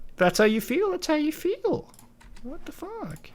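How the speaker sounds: high, mocking, sing-song shrug.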